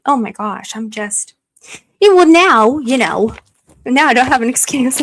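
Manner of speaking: ordinary delivery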